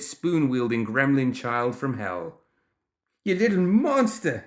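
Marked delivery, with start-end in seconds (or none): 3.2-4.4 loud, high, outraged holler; pained, furious yell. A narrator's reading. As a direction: holler at a tiny tormentor. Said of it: "he hollered, staggering".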